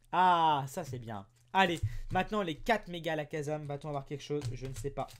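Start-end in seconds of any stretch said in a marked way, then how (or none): none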